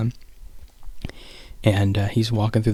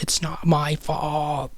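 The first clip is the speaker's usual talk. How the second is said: higher, drawn-out mock yell; held-back yell; 6 semitones higher, much slower than usual. Boy protesting to his mom, higher held-back yell. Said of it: "I'm not gonna yell it".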